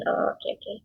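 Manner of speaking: very soft, almost whispered musing; hushed realization. Acted. Reader getting the main point, hushed self-talk. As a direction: murmur it under your breath as it clicks.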